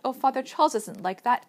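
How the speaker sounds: urgent plea, high and loud; crying out. A narrator's reading. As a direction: protest loudly to a father. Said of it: "Meg cried".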